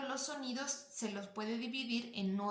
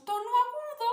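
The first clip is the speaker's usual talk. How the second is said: high-pitched demonstration voice; 12 semitones higher, 6 dB louder than usual. Shown as a demonstration. Teacher asking students to identify their tone, high-pitched. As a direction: lift the whole phrase into a high register. Voices each tone option as named.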